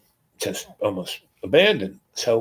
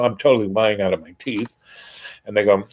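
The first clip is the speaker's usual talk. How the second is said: usual speaking voice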